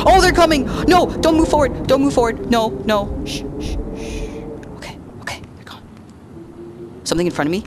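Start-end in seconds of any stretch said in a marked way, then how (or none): 0.1-3.2 high, loud frightened yells; panicked yelling. Real, not acted. Terrified player yelling at approaching monsters, loud panic. 3.3-5.8 whispered shushing; frantic whisper. Real, not acted. Hiding player shushing himself, whispering.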